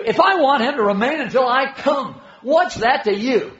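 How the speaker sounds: acted exasperation on the edge of sarcasm; sarcastic. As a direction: say it loud and high, with a sarcastic edge. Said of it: "I'm sure this is full of sarcasm".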